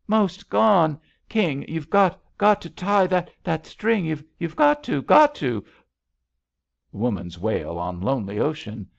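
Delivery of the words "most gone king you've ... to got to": high, loud, stammering urgency; desperate pleading. A narrator's reading. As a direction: beg frantically, repeating words in panic. Drowning woman pleads urgently.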